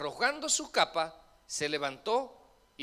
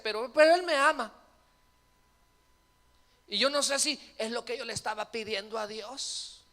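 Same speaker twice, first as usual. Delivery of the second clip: mock woman parishioner; 5 semitones higher than usual. Pastor voices a woman parishioner.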